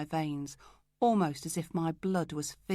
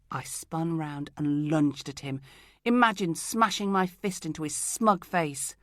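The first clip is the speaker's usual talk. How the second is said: plain reading voice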